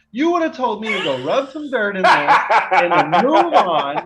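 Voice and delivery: high, loud, laughing mock-tough dad. Son teasing his dad, laughing mock-tough voice. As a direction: laugh through the dad's old tough-guy line.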